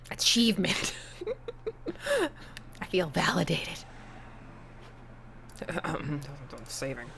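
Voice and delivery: whispered to himself. Real, not acted. Player quietly savoring the achievement, whisper. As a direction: hush it like a private win.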